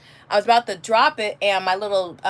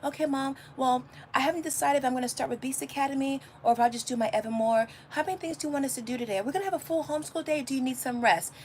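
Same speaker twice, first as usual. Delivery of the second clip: put-on soft, quick, slightly higher kid voice; calm kids' voice; 4 semitones higher, 7 dB quieter, faster than usual. Mom imitating her calm kids planning the homeschool day, soft and quick. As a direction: rattle it off lightly like a reasonable child. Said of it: "They're like".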